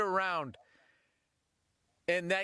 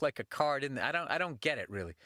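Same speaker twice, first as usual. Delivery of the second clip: usual speaking voice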